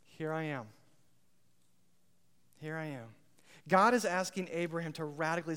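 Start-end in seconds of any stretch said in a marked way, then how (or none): 0.0-3.2 soft, humble answer; quiet surrender. Acted. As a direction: give yourself over quietly. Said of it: "you say".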